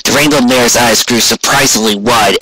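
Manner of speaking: ordinary delivery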